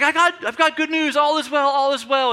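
high, loud, urgent announcement; excited runner's shout. Acted. Runner races toward the king with news, excited high shouting. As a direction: shout it while racing in with great news. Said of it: "picture him running".

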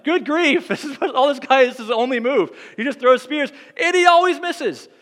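exasperated disbelief. Real, not acted. Preacher exclaiming to the congregation in exasperated disbelief. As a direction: throw up your hands at Saul again. Exasperated aside about Saul's spears.

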